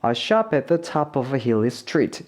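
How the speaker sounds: A SHOP AT THE TOP OF A HILLY STREET flowing as one phrase; natural linked reading. Shown as a demonstration. Teacher reading naturally for learners, A SHOP AT THE TOP OF A HILLY STREET smoothly linked. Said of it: "좀 자연스럽게 읽어보면".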